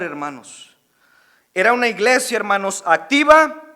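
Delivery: loud, fired-up sermon delivery; preaching. Acted. Pastor preaching loudly to his congregation. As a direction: preach it out to the whole church, full force.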